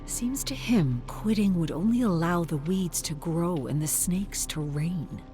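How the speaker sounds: plain reading voice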